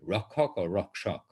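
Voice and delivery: Rakhak then Rakshak, contrasting kh against sh; two pronunciations. Shown as a demonstration. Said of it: "contrasting two pronunciations".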